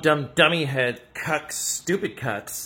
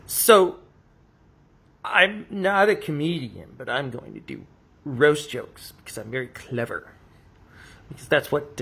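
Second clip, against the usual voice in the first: normal talking voice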